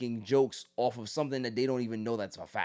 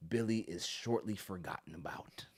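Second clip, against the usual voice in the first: whispered punchline; hushed aside; 5 semitones lower, 9 dB quieter than usual. Real, not acted. Revealing Billy is forgotten, whispered. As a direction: let it slip out in a whisper.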